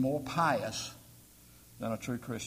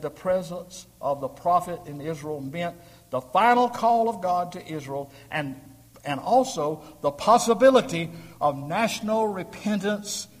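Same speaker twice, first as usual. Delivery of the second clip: building louder and higher through the quote; preacher swelling into full voice; rising preaching; 4 semitones higher, 8 dB louder than usual. Acted. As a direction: climb toward the word repentance.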